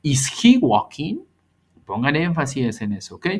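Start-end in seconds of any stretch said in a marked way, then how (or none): 0.0-1.2 slow, loud, rising on he; correct question. Shown as a demonstration. Teacher modelling the right question, slow and rising. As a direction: ask it clearly and lift at the end. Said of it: "la pregunta tiene que decir".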